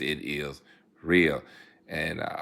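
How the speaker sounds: ordinary talk, nothing marked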